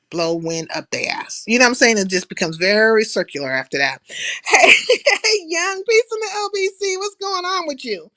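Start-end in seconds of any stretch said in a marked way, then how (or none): 4.5-8.1 high, bright-pitched hello to a viewer; sing-song greeting. Real, not acted. Streamer greeting a chat viewer, high sing-song hello.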